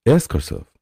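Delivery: ordinary talk, nothing marked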